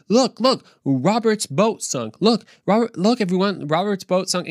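high-pitched imitation of a kid announcing a mishap; tattling kid. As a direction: point it out to everyone like a gleeful child. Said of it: "that one kid who had to point it out".

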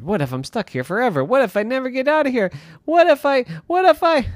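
put-on high, fast, rising child panic; a kid spiraling into worry; worried boy. Irwin fearing he's stuck forever, fast high worried voice.

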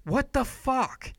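mock disbelief. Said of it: "it's like".

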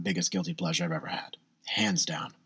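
whispered, like owning up to a secret; confiding whisper. Real, not acted. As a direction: let it slip in a whisper. Confiding his guilty pleasure.

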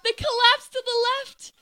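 high-pitched director calling the action; hammed-up high director voice; frantic film director. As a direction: urge the actors on from behind camera. Director shouting instructions during take.

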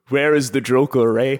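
loud, hammed-up Canadian accent; terrible Canadian accent. Riffing with his co-host, a loud mock Canadian Batman accent. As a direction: bark it out as a comic Canadian Batman. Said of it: "What a terrible Canadian accent".

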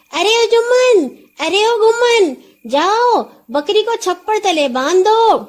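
high, loud call to the servants. A narrator's reading. Nora calls servants to tie up the horse, loud call. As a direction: call across the yard to the boys. Calling servants across the yard.

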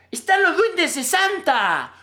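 put-on high, slow, loud child's cry of discovery; a little girl shrieking with amazement; excited child shout.

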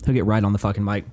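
ordinary delivery

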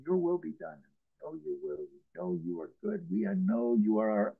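very low, soft prayer; hushed surrender. Acted. Believer hands it over to Abba, hushed in surrender. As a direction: pray it quietly, sinking deep in pitch.

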